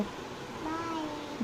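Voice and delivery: barely voiced slow bye; a tiny, shy bye under her breath; soft little bye. Real, not acted. Daughter's shy goodbye to mom, very soft. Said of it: "जोर से।".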